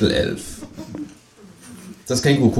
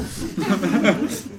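laughing; 11 semitones higher, 4 dB quieter than usual. Real, not acted. Laughs after poem.

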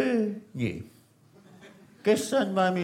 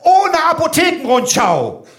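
loud, high mock-cruel ultimatum; hammed-up threat punchline; 9 semitones higher, 8 dB louder than usual. Interrogator topping the threat, loud and mock-cruel. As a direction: sell the cruelty as a joke.

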